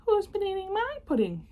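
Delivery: very high squeaky little bear. Acted. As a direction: tiniest bear whines in a squeak. Third little bear voiced.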